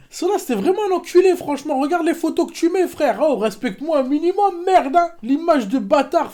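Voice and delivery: fast, high-pitched indignant rant; excited rant. Real, not acted. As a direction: rattle off outraged complaints. Ranting at the photo.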